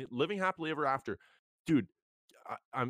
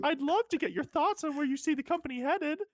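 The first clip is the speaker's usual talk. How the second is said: high flirty falsetto, louder; flirty woman voice; 4 dB louder than usual. Pretend. Plays seductive woman avatar.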